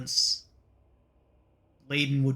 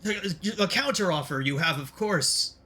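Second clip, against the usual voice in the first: higher, stammering villain backpedal; flustered bargaining villain; 5 semitones higher than usual. Acted. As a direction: scramble to keep the deal alive. Villain scrambles to save the deal.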